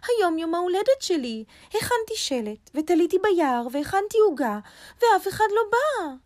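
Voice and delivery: high-pitched, aggrieved rabbit; a bit sad, a bit angry. A narrator's reading. Rabbit tells lion nobody came, sad and cross. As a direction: pour out a high, hurt complaint. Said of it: "אמר ארנב בקול קצת עצוב וקצת כועס".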